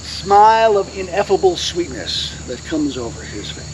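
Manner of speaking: low, soft, with long pauses; hushed solemn telling. A narrator's reading. Lecturer tells of Jackson's last moments, hushed. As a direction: drop low and soft, letting each phrase settle.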